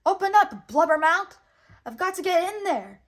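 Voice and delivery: loud high furious yell. A narrator's reading. Jiminy pounding on the whale's teeth, loud high yell. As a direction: bang on the door and yell to be let in.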